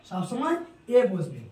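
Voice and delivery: ordinary talk, nothing marked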